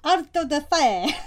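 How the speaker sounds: put-on "Auto da fe" with a Spanish accent, slow and loud. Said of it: "in a Spanish accent".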